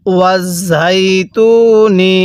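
ওয়াজ ঝাই তু নি with held ঝাই and long তু; drawn-out recitation. Shown as a demonstration. Teacher shows learners how to read, drawn-out ওয়াজ ঝাই তু নি. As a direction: stretch ঝাই and তু evenly. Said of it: "কিভাবে পড়তে হবে".

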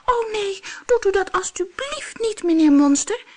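tiny goat begging, high pitched; squeaking goat. Acted. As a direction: squeak it like a frightened kid goat. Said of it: "piepte het bokje".

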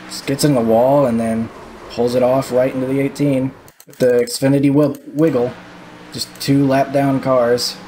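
normal talking voice

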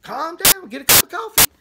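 very high, excited catchphrase voice; hammed-up high exclamation; Paul Begley impression. Minister mimicking what Paul Begley says on every video, high and exclaiming. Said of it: "he's gonna say".

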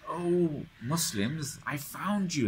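high, eager calling out; calling out to the Muslims with relief; joyful shout. Retold or imitated. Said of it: "and he's shouting".

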